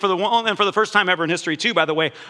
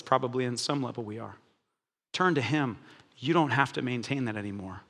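soft appeal; 7 semitones lower, 6 dB quieter than usual. Real, not acted. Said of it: "soft closing appeal".

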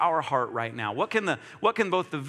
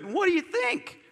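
much higher, eager question; hopeful friend; 12 semitones higher than usual. Retold or imitated. Preacher voicing a friend asking his opinion, high and eager. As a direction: voice a proud friend seeking praise.

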